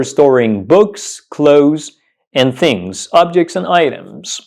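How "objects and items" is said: ordinary talk, nothing marked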